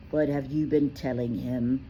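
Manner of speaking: usual speaking voice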